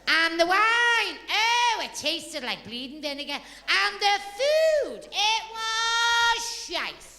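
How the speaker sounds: high, slow, drawn-out complaining; disgusted moaning. Retold or imitated. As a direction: drag out the disgust. Hairdresser moans about Italy.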